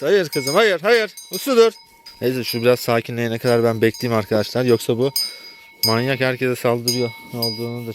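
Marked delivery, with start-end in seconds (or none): 0.0-1.7 much higher and louder, sharp repeated no; stern shout. Real, not acted. Farmer yelling at an attacking cow, loud stern shout. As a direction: stop the animal right now.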